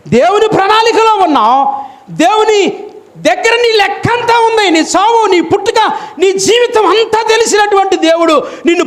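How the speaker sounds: high, shouted sermon climax; preaching at full force; fiery preaching. Acted. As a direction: thunder it over the congregation.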